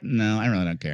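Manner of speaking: low, aloof, mumbled Shatner impression; imitating drunk Shatner. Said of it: "He's like".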